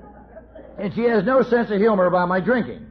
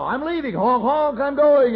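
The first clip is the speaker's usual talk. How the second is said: higher, louder hammed-up farewell call; mock loud announcement; 7 semitones higher, 4 dB louder than usual. Drunk husband announcing his exit to the neighborhood, hammed-up loud. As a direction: call it out for the whole street to hear. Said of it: "he tells everybody".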